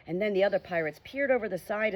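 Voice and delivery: plain reading voice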